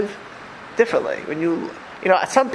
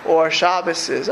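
normal talking voice

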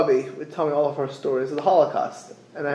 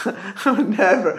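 laughing through the words, slower; 5 semitones higher, slower than usual. Real, not acted. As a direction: laugh while saying it. Laughing repeat of disclaimer.

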